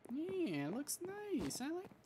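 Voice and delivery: sarcastic falsetto compliment; mock sweet voice. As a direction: chirp it in a sugary put-on voice. Mock-sweet reaction to decor.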